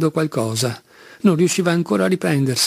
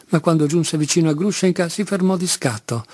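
usual speaking voice

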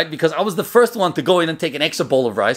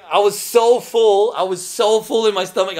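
agonized emphasis; 6 semitones higher than usual. Retold or imitated. Retold agony of being overfull.

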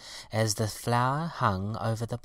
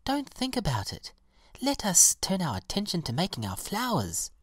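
high-pitched, gentle encouragement; encouraging violet; 9 semitones higher than usual. A narrator's reading. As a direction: coax a friend along in a high voice.

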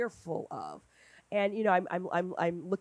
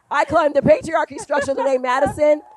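triumphant joke; 8 semitones higher, 11 dB louder than usual. Retold or imitated. Punchline joke to daughter.

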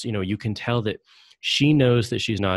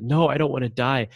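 higher-pitched mild plea for life; imitated tearful pleading; 6 semitones higher, 3 dB louder than usual. Host retells android tearing up, mild pleading. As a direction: lightly act out begging near tears. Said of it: "he's tearing up".

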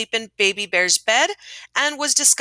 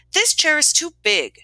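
higher-pitched storybook complaint; exclaiming; 7 semitones higher than usual. A narrator's reading. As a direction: exclaim it lightly as Goldilocks. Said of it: "she exclaimed".

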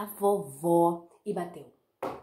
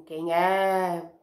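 wolf faking grandma; 2 semitones lower, 6 dB louder than usual. Acted. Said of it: "o lobo disfarçou a voz de vovó".

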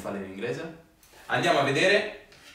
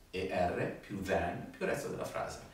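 much softer, lower echo of the rule; soft repeat; 6 semitones lower, 12 dB quieter than usual. Shown as a demonstration. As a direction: murmur the rule back. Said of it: "Ripetete con me". (ripetizione sottovoce)

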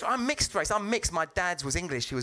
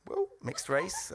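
ordinary delivery

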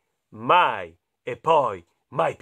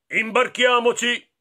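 raised, loud pirate call; pirate proposing; 8 semitones higher, 7 dB louder than usual. A narrator's reading. Pirate urging the boy aboard, loud high call. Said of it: "propose il pirata".

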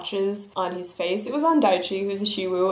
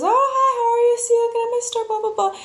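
gushing shop artist; 13 semitones higher, faster than usual. Retold or imitated. Artist gushing, voiced in story.

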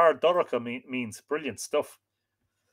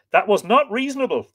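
lightly scolding, pitched up and louder; playful, raised-voice reproach; blithe chiding; 8 semitones higher, 6 dB louder than usual. A narrator's reading. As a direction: chide with a blithe heart. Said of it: "chided with a blithe heart".

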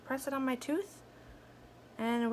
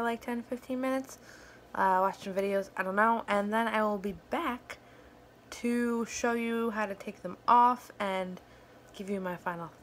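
teeth caps affecting speech; slight lisp from fangs; speaking with fake fangs. Real, not acted. Seen 1: Pointed fake fangs visible on upper canines throughout labelled speech.

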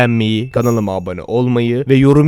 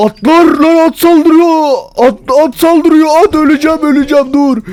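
very high, loud shrieks of alarm; high-pitched gamer panic; panicked screaming; 5 dB louder than usual. Real, not acted. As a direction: shriek a warning as you're attacked. Horses attacking him in game.

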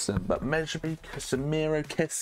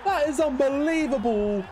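higher, thrilled commentary call; excited; 7 semitones higher than usual. Real, not acted. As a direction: call the hat-trick with delight. Hat-trick on debut.

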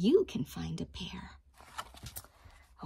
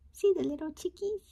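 fast, higher cooing question; 9 semitones higher, much faster than usual. Acted. Teacher coos to children over chicks. Delighted aside about chicks.

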